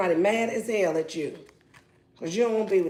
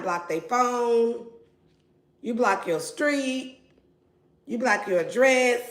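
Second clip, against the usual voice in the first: ordinary talk, nothing marked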